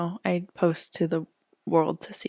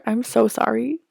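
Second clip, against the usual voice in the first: normal talking voice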